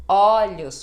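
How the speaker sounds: Olhos loud, first O open; open O in plural. Shown as a demonstration. Teacher showing the plural Olhos with open O. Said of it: "o primeiro O vai ficar O".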